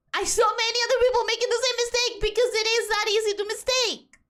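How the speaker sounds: high, fast, run-on self-defence; rapid insistence. Real, not acted. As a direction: rattle it off in one high stream. Insistently repeats defence of own mistake.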